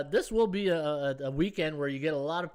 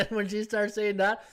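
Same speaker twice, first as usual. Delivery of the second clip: laughing; 4 semitones higher than usual. Real, not acted. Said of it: "I just started laughing".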